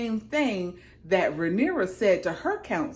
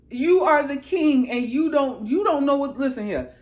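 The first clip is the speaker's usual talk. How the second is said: higher, louder telling-off; pitched-up exasperation; exasperated scolding; 6 semitones higher, 4 dB louder than usual. Real, not acted. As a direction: scold like you've had enough of him. Scolds the character as if present.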